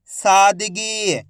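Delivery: सादगी very slowly sounded out; drawn-out long ई. Shown as a demonstration. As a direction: stretch सा and गी in सादगी. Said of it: "खींच के पढ़ा जाता है".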